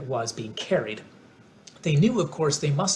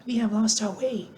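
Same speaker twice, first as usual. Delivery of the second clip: Dorothy voice; 8 semitones higher than usual. A narrator's reading. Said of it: "said Dorothy".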